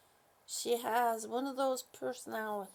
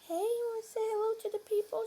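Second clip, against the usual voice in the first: high, fast coaxing; 9 semitones higher, faster than usual. Real, not acted. Asking her dog to greet the viewers, chirpy baby talk.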